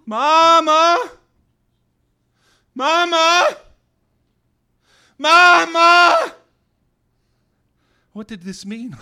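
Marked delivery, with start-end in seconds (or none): 0.0-6.3 repeated high, very loud calls; shouting. A narrator's reading. Sick child keeps calling an unhearing mother, escalating shouts. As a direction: shout each one louder, held high. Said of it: "I was sure I was shouting".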